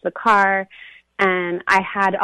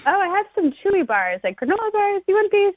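much higher, sweet offering voice; higher sister voice; 12 semitones higher than usual. Retold or imitated. The sister offering granola bars, much higher voice. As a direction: lift way up like a kind auntie.

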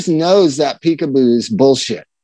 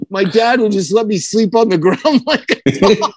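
laughing; 3 semitones higher than usual. Pretend. Voicing grown-up kid, laughing.

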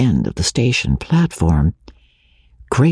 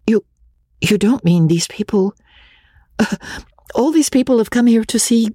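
lightly voiced woman in shocked disbelief; ask, hardly believing it; higher, incredulous lady; 10 semitones higher than usual. Magnolia, shocked.

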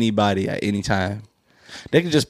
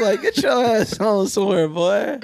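high, indignant viewer voice; mad viewer; 8 semitones higher than usual. Retold or imitated. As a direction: scold like an annoyed viewer. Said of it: "They gonna be mad at you".

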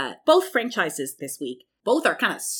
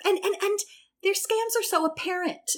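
normal talking voice

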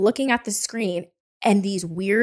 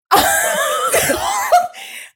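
high squealing laugh. Real, not acted. Laughing at the ghost story.

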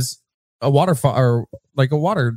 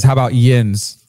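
Pittsburgh yins. Retold or imitated. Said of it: "Frank says".

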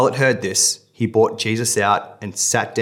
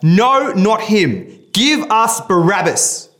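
high, loud crowd shout, slowing on Barabbas; crowd shouting back; 11 semitones higher, 6 dB louder, slower than usual. Acted. The crowd shouts back at Pilate, demanding Barabbas, loud and high. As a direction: shout it back like an angry mob. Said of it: "They shouted back".

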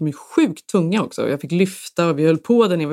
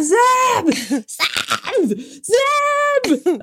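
screaming for Seb; much slower than usual. Retold or imitated. Calling husband down to the bouncing washer, panicked high yells. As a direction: yell the name up the stairs in panic. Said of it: "jag bara skriker på Seb".

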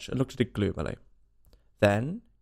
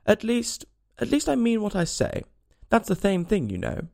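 flustered; 8 semitones higher than usual. A narrator's reading. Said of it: "Alice hastily replied".

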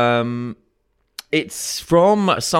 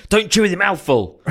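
higher, louder dad impression; imitating dad; 8 semitones higher, 5 dB louder than usual. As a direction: mimic your dad getting it wrong. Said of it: "like this".

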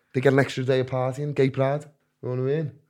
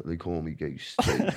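lower, softer, slow menacing line; mock murderer; 7 semitones lower, 10 dB quieter, slower than usual. Shown as a demonstration. Telling a new prison lad his nickname, low mock murderer menace. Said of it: "I said that like a murderer".

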